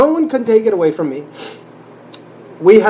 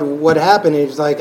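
ordinary talk, nothing marked

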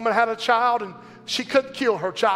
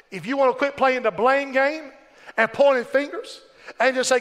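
ordinary talk

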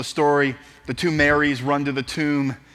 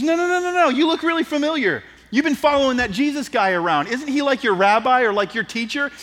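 high-pitched insistent seventh-grader; persistent schoolgirl; 9 semitones higher, 4 dB louder than usual. Acted. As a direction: press the point in a high girlish voice. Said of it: "she's a little persistent".

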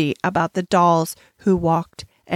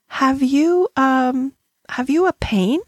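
higher, hesitant question with an um; tentative venturing; 5 semitones higher, slower than usual. A narrator's reading. Schoolgirl asks her troubled friend, tentatively. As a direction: venture it carefully, pitched up. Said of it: "Ermengarde ventured".

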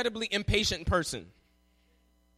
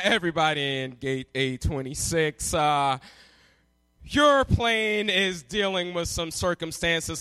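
airline gate announcer; 8 semitones lower than usual. Retold or imitated. Gate agent stalls waiting passengers, announcing. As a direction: drag out the bad news like a gate agent. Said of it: "on the P A and was like".

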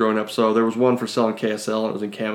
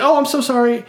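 much higher apologetic voice; imitated embarrassed apology; 12 semitones higher than usual. Clerk walks in on the man at the urinal, imitated high apology. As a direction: mimic a woman apologizing as she backs out.

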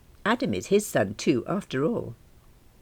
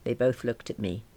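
plain reading voice

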